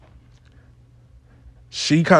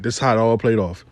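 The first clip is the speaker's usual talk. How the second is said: usual speaking voice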